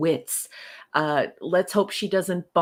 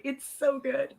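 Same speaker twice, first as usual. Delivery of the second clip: normal talking voice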